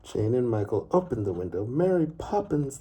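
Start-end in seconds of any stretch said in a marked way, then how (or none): 1.6-2.8 high, loud shout of her name; children shouting after someone leaving. A narrator's reading. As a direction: call after her as she flies off. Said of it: "they shouted".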